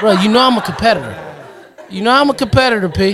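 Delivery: laughing protest. Real, not acted. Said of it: "Nah, man".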